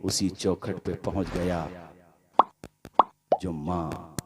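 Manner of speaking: low, soft, grieving narration; hushed sad storytelling. Acted. Preacher tells the ruined son's fall, hushed and grieving. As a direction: draw them into the son's downfall quietly.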